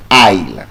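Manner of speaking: "Aisle" low and clear as "eye-l", silent s. Shown as a demonstration. Teacher reveals the word the class spelled. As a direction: say "Aisle" like "isle". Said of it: "what they have spelled is aisle".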